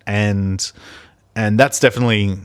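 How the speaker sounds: ordinary delivery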